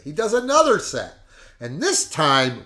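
ordinary talk, nothing marked

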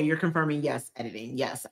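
ordinary talk, nothing marked

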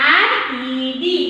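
letters spelled slowly one by one; slow spelling out. Shown as a demonstration. Teacher slowly spells the word for children on the board. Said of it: "જુઓ".

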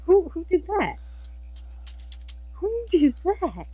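high, drawn-out mock questioning; playful squeal. High-pitched joking question.

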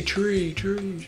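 high, fading echo of tree; vocal reverb echo. Pretend. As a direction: fade the word out like reverb. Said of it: "I make my own reverb".

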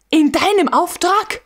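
a sudden high shocked outcry; cry out. A narrator's reading. Koltow hears his friend planned the runaway horses, crying out in shock. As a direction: jolt up high in disbelief. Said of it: "schrie Koltow auf".

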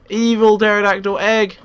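usual speaking voice

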